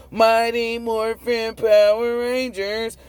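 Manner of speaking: slow, high sing-song announcer voice; mock announcer. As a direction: mockingly announce the cartoon title. Mocking cartoon hero show.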